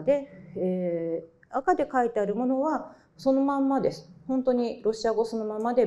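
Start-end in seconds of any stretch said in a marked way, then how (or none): none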